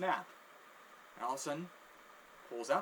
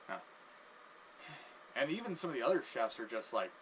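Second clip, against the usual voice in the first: ordinary talk, nothing marked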